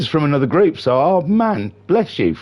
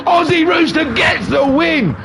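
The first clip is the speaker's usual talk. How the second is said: screaming photo finish; 14 semitones higher than usual. Real, not acted. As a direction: belt it out as they cross side by side. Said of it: "My voice hurts".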